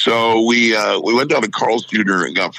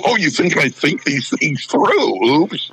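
higher, slow, sarcastic self-mockery; 6 semitones higher, slower than usual. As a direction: drag it out like a cheeky confession. Mocking himself in quote.

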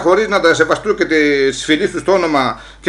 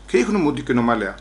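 ordinary talk

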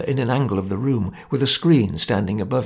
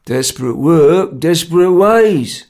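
laconic; 5 semitones higher, 6 dB louder, slower than usual. A narrator's reading. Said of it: "Jerry replied laconically".

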